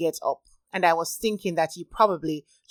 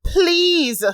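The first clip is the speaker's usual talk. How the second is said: a higher, louder, desperate "please"; desperate begging; 11 semitones higher, 5 dB louder than usual. A narrator's reading. Narrator begging again, higher and more desperate. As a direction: beg harder, voice rising. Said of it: "I begged".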